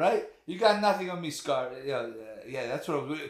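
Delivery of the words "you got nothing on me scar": louder, higher gangster taunt; Scarface quote. Retold or imitated. Quoting Scarface again for his friend, a cocky taunt. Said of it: "Was it Scarface?".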